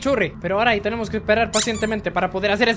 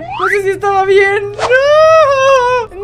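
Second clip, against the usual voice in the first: high, louder whimpering cry; mock crying; 11 semitones higher, 9 dB louder, much slower than usual. Real, not acted. Player fearing the wrong order, mock crying. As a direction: whine it out like near tears. Fears failing puzzle, whimpers.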